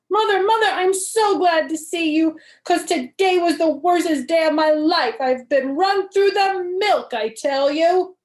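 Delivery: high and loud, overwrought; dramatic wail. Acted. Girl hugging her mother's legs after school, dramatic. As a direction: pour out the worst day ever.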